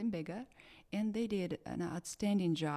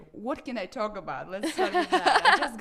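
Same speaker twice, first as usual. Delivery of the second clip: laughing; 7 semitones higher, 13 dB louder than usual. Real, not acted. Laughs while choosing what to reveal.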